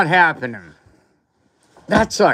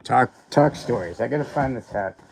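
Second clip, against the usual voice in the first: ordinary delivery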